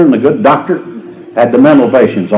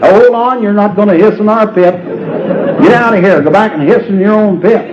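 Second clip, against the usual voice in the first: fussy lady neighbor; 7 semitones higher than usual. Retold or imitated. Missus Potts shoos baby snake.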